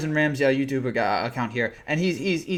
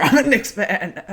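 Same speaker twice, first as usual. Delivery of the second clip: laughing; 5 semitones higher, slower than usual. Real, not acted. Laughing confession of Knicks fandom.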